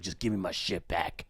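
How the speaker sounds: Knife Guy demanding his knife back; low thug demand. Retold or imitated. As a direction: grumble it like a menacing stranger.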